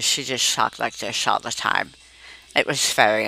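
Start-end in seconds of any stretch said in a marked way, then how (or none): 0.0-1.9 slurred, toothy mumbling impression; Flo's speech. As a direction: talk as if your teeth are too big for your mouth. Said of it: "pretending to talk like her".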